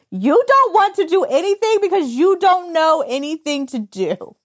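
high, loud comic exasperation; hammed-up, high-pitched complaint; exasperated rant. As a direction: let it burst out at the baby. Mock exasperation at newborns.

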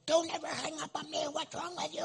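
a squeaky put-on fury; mock angry inmate. Inmate scolding the pastor for hanging up, mock anger.

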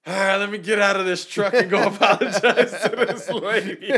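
high, loud and laughing; laughing grudging surrender. Retold or imitated. Retelling giving in to God, a loud laughing surrender. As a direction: give in while laughing at yourself.

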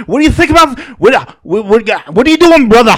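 channel Dusty playing Hulk Hogan; Dusty Rhodes as Hogan. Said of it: "when you think about Dusty Rhodes being Hulk Hogan".

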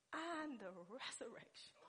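hushed, whispery declaration; calm. Acted. Preacher repeats Jesus' answer for listeners, calm and hushed. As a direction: quietly let each word sink in. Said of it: "I believe Jesus said calmly".

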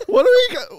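mocking a cohost questioning the title; imitating a puzzled colleague. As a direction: ham up his questioning. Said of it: "He's like".

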